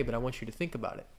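ordinary delivery

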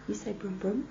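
soft prompt. Shown as a demonstration. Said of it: "waiting for him to say something".

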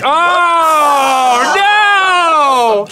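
sudden high disgusted yell; shocked shout. Real, not acted. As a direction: cry out in shock. Reacts to gross drink.

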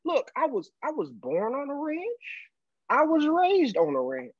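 high, stretched-out cowboy twang; thick Texan accent. Retold or imitated. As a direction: imitate a proud Texas rancher. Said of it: "and this thick accent".

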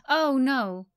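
alarmed cry. Acted. Character alarmed at checkpoint.

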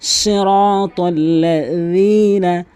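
chanted সিরাতাল্লাযীনা with th-as-in-this before long i; soft dhal. Shown as a demonstration. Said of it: "দালের উচ্চারণ". (সিরাতাল্লাযীনা-তে যাল, সুরে টেনে)

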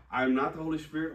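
normal talking voice

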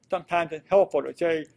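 normal talking voice

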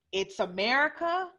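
normal talking voice

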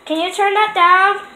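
shouting to another room; loud call to her sister. Real, not acted. Yelling to sister about TV.

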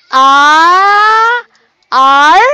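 "R" held very long, then repeated firmly; isolated first syllable. Shown as a demonstration. Teacher sounding out a name's syllables, "R" drawn out. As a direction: stretch "R" out as one syllable on its own. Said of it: "how many syllables do we have".

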